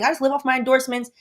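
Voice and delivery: high, fast celebrity brag; mock Shaq voice. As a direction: toss it off like a carefree star. Imitating Shaq.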